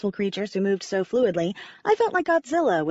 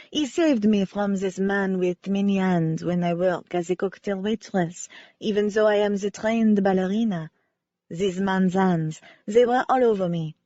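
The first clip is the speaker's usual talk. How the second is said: lightly voiced French ballerina, sincere; a hint of French accent, remembering gratefully. Ballerina Cece telling the amateur sleuth how Wynne saved her, light French accent.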